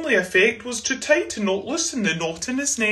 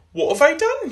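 high, loud cry of dismay; 8 semitones higher, 5 dB louder than usual. A narrator's reading. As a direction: cry out in a sudden panic. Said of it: "he cried".

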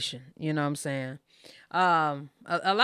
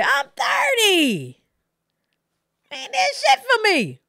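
high, loud, outraged and cracking; heated, pitched-up outburst at his mother; angry high rant; 7 dB louder than usual. Real, not acted. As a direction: vent the betrayal at full pitch. Angry rant at mother about taxes.

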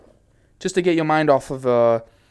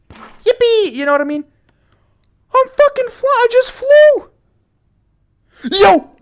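much higher, louder squeals; shrieking joy; 9 dB louder than usual. Real, not acted. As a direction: squeal with delight mid-air. Shrieking while flying in game.

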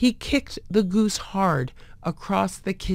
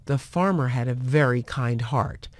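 usual speaking voice

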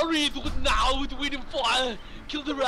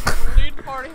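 louder, pushed second take; louder repeat; 2 semitones higher, 13 dB louder, faster than usual. Pretend. Gamer repeating his exit line, louder. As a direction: belt the line the second time.

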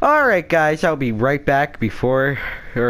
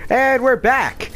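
upbeat greeting; 11 semitones higher than usual. Real, not acted. Video return greeting.

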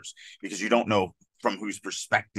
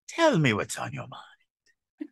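calm; 6 semitones higher, slower than usual. Shown as a demonstration. Critic showing co-host the other tone, calm. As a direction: gently invite them to open up, unhurried. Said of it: "it's this much more calm".

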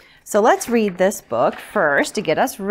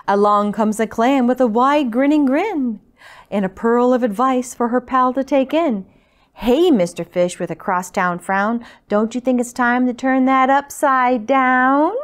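lively, louder picture-book reading; story narration; 3 semitones higher, 6 dB louder than usual. A narrator's reading. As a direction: read it out rhythmically to little listeners. Reads picture book to children.

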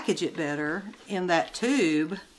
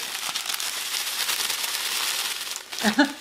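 breathy laugh; 5 semitones higher than usual. Real, not acted. Laughing at a snake-like hiss.

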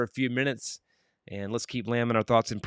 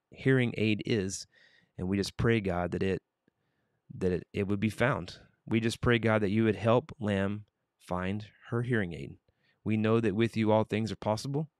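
earnest prayer; 3 semitones lower than usual. Acted. Host praying for Lamb's lost hearing aid, earnest.

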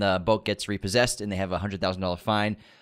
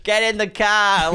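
slow, loud, higher mock Boston accent; 9 semitones higher, 5 dB louder, slower than usual. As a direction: drag it out like a Boston tough guy. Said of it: "no Boston accents in the trailer except for one guy's".

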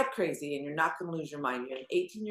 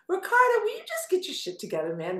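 scolding parent; 12 semitones higher than usual. Pretend. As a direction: nag him like an exasperated mom. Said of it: "If you were my son, I'd be going".

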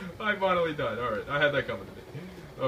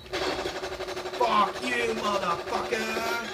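higher, slow drawn-out curse; angry outburst; 7 semitones higher, much slower than usual. Real, not acted. Gamer cursing the player who just killed him.

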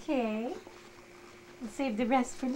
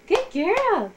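excited praise; 8 semitones higher, 7 dB louder than usual. Real, not acted. Praising baby's clapping.

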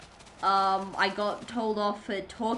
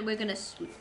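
ordinary talk, nothing marked

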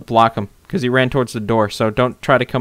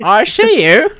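teasing sing-song; 9 semitones higher, 7 dB louder, slower than usual. Pretend. Teasing peeping friend.

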